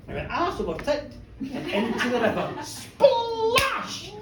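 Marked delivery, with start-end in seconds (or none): 2.6-4.1 splash. Retold or imitated. Sound effect of fall.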